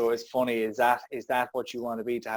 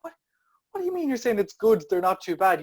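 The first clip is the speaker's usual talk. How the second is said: incredulous exasperation; 8 semitones higher, 4 dB louder than usual. Real, not acted. Exasperated question at stock reply.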